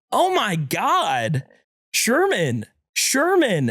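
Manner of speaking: imitating a frightened wife calling out; wife in a panic over her husband on the floor, calling his name; panicked wife.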